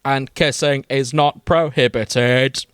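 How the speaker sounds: slow mechanical monotone scream, understated; Dalek. Acted. Dalek replies to Amelia's flirting, staccato robotic voice. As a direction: intone each word like a robot alien. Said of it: "he replies in his delicate scream".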